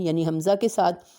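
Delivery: ordinary talk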